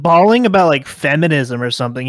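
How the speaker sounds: ordinary talk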